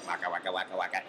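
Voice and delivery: Pac-Man waka imitation; video game noises. Actor mocks old game sounds with a waka chomp. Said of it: "I only know".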